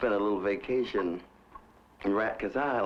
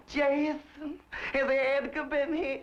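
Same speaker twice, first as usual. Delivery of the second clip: high, fussy lady of the house calling her houseboy; imitating his employer; 14 semitones higher, slower than usual. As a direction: mimic the high-strung madam summoning the servant. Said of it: "she'd enter and say".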